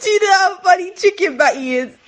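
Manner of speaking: laughing shout. Real, not acted. Can't breathe from laughing.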